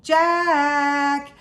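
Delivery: high, long singsong call; drawn-out mother's call across the distance; distant cry. A narrator's reading. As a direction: call out two names from far off, each held long. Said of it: "came the distant cry again".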